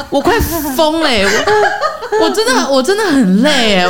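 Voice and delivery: laughing exasperation. Real, not acted. Complaining to a friend about grannies, laughing. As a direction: laugh out your frustration.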